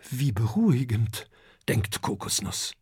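ordinary delivery, nothing marked

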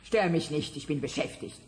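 normal talking voice

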